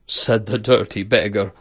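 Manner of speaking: plain reading voice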